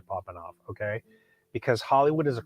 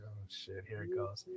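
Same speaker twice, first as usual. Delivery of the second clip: much softer, lower muttered dread; resigned under-breath aside; 3 semitones lower, 20 dB quieter than usual. Retold or imitated. Watching the fight start outside, soft muttered dread. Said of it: "And I'm just like".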